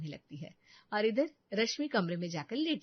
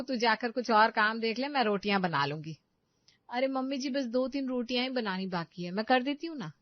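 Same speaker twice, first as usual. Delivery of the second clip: ordinary delivery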